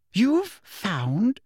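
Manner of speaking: high shocked You've found?; You've found? squeaked in disbelief; shrill. A narrator's reading. Umbridge stunned by Dumbledore, shrill. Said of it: "said Umbridge shrilly".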